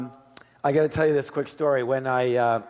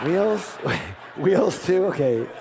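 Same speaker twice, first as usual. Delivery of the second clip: laughing mock surprise; 5 semitones higher, much slower than usual. Retold finance lady reacting.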